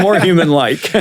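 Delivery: laughing while talking. Real, not acted. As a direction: say it through a laugh. Laughing agreement.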